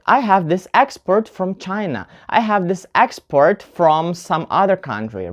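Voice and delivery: export as EX-port inside each sentence; noun stress in export. Shown as a demonstration. Teacher giving noun examples, export stressed on EX. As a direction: hit the EX in export. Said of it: "put the stress on the first syllable".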